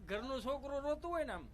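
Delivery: normal talking voice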